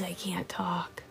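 breathy whisper, slow; hushed, can't talk aloud. Real, not acted. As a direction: whisper so no one hears. Whispering during live stream.

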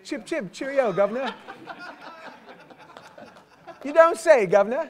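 hammed-up, high and loud mock British toff; mock British accent. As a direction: play a cartoon English gent, big and loud. Mock posh British greeting.

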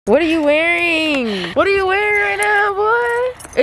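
high incredulous what-are-you-wearing; mock scolding. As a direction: tease like an outraged aunt. Scolding someone in sandals.

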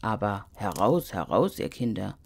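low, calling snowman voice. Acted. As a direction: call the children out in a deep snowman voice. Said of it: "rief der Schneemann". (tiefer Schneemann, rufend)

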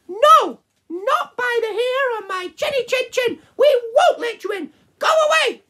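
very high, loud squeaky piggy voice; defiant piglets shrieking back; squeaky little pigs. Acted. Piggies refusing the wolf, very high squeaky voice.

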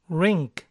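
"Rink" said ɹɪŋk, no u sound. Shown as a demonstration. Teacher repeats the model word for students, careful. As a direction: say "Rink" cleanly. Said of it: "se llega a la misma pronunciación".

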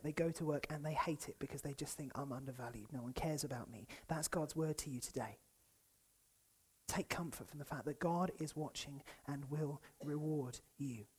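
quiet pastoral reassurance; gentle comfort. Real, not acted. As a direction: lean in and console the hurting. Comforting the upset.

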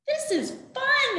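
happy cheer. Acted. Animals cheer, high voice.

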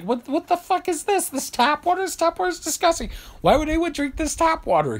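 high indignant rant about tap water; Larry David rant. Retold or imitated. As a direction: blast it like an appalled Larry David.

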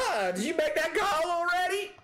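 very high put-on southern voice; exaggerated high southern twang; southern accent. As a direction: ask it in a squeaky southern accent. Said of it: "hear [name] do that voice".

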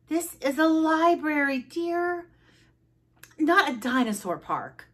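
high-pitched, measured reproof; librarian. Acted. Librarian to roaring Froggy, high chiding voice. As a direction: chide him primly from up high.

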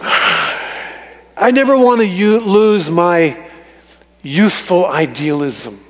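usual speaking voice

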